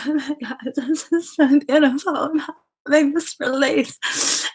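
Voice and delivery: high, loud, gushing and emotional. Retold or imitated. Retelling sharing while overcome, in a high gush. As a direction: gush it out like an overwhelmed devotee. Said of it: "being like".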